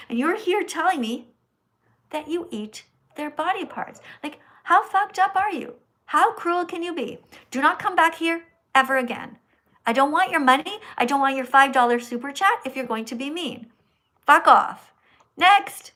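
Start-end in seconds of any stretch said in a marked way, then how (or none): 4.2-15.9 furious scolding. Real, not acted. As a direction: tell them off, then bark the last words. Scolding a meat-eating commenter.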